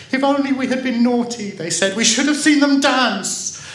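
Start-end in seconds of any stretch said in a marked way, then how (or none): 0.1-1.5 put-on high, loud lament of children; regretful wail. A narrator's reading. Children left behind by the girl, wailing regret. 2.0-3.6 put-on high, loud childish lament; regretful wail. A narrator's reading. Children who missed the dancing, wailing regret.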